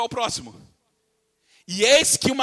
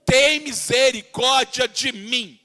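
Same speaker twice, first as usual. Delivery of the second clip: slow, loud, pleading cry to Jesus; clamoring plea; 2 semitones higher, 4 dB louder, much slower than usual. Retold or imitated. A mother begging Jesus for her possessed daughter, slow loud plea. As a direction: drag it out like a desperate mother begging. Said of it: "falando assim".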